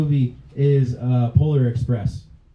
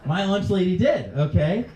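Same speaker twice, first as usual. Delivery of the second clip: ordinary delivery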